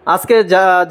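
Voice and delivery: ordinary talk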